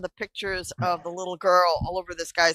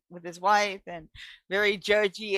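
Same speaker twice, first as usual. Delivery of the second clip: normal talking voice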